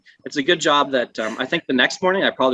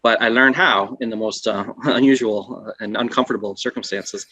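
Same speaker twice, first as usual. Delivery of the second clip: ordinary talk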